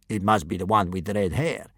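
menacing sidekick; second kidnapper voice. A narrator's reading.

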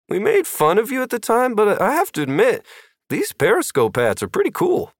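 high, light character voice. Acted. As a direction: lift the voice up for Brewster. Brewster voiced in story.

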